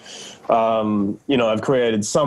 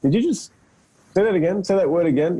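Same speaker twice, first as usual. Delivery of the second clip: lightly acted query in a higher voice; questioning castmates; 7 semitones higher, faster than usual. Castmates catching his accent slip, mock questioning. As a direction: imitate puzzled castmates, pitched higher.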